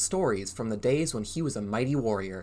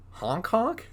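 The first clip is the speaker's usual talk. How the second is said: amused surprise; 9 semitones higher than usual. Real, not acted. Amused at silly word.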